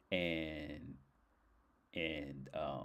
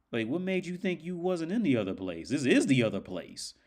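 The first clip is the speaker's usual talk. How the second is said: high, emphatic mock reveal, getting louder; spring the twist in a raised voice; imitated taunting guide; 9 semitones higher, 5 dB louder than usual.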